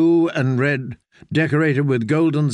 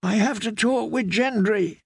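weak wheeze; 8 semitones higher than usual. A narrator's reading. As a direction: push it out through pain. Wounded woman pleading weakly.

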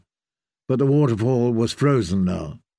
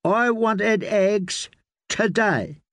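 put-on high, very slow sulky child voice; unappeased boy; 7 semitones higher, much slower than usual. A narrator's reading. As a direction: drag it out like a stubborn spoiled child. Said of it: "The boy was unappeased".

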